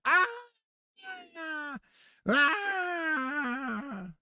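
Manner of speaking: high, loud cartoon yelp of a falling character; playful imitated scream; mock scream. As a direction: yelp it out like someone dropping off a paddle.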